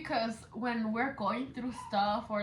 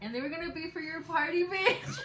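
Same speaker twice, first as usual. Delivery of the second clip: laughing; 7 semitones higher than usual. Real, not acted. Laughing while recalling party bottles.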